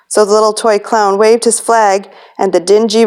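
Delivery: plain reading voice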